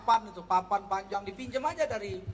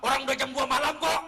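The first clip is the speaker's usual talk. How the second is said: acted retort; 4 semitones higher, 4 dB louder than usual. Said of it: "Jangan jawab".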